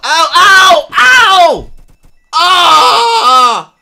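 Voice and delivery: loud, high yelps of pain. Real, not acted. Player touching a laser in the game, loud pained yelps. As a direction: yelp at the laser's sting.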